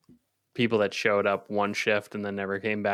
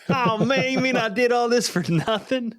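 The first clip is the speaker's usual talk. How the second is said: much higher, crestfallen mock whine; whiny high voice; 13 semitones higher, 4 dB louder than usual. Cook mocking the new guy's disappointment, high whine. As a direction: squeak out the new guy's dismay. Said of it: "He's like".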